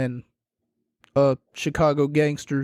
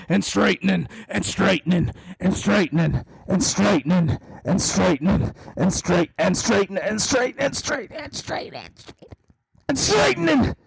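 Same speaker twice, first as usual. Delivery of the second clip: mimicking repetitive commentary; 3 semitones higher than usual. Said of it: "I'm starting to lose my voice already".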